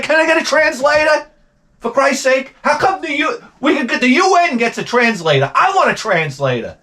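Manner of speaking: high-pitched exasperated mock yell; retold yelling. Man demanding a translator at home, mock yelling. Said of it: "I start yelling at her".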